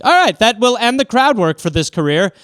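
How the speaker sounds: hammed-up announcement to the crowd; mock sign-off. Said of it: "And I was like".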